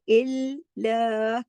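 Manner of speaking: इल्ला said slowly, the ल thin and light, held double; light thin reading. Shown as a demonstration. Teacher shows the light lam to students. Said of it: "बारीक पढ़ना है".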